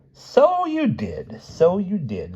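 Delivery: put-on very high, drawn-out old lady voice; eerie old woman. A narrator's reading. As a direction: stretch it out high and witchy. Narrator voices old Missus Bayfield.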